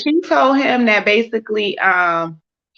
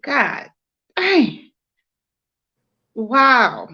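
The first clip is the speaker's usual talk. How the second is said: mock shock; much slower than usual. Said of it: "I said".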